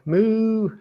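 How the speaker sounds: high, loud moo; loud cow moo. Retold or imitated. Letter writer interrupts his cousin's joke with a loud moo. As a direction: blurt a cow noise to interrupt. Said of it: "I said, 'Moo,' right in the middle".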